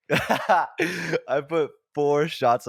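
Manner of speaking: slow and high, laughing through each word; fighting the giggles to finish. Real, not acted. Laughing through the key line.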